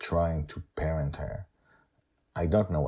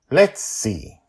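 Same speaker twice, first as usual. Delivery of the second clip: testy; 8 semitones higher, 8 dB louder than usual. A narrator's reading. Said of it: "Justin says testily".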